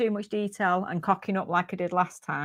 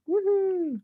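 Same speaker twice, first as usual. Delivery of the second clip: excited woohoo; 12 semitones higher than usual. Real, not acted. Cheering a sale.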